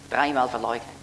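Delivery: usual speaking voice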